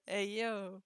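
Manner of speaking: put-on a clumsy American accent, half sung, slow; mock American accent, tongue in cheek; bad American accent. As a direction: sing it in a lightly done, bad American accent. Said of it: "Avec mon accent américain nul".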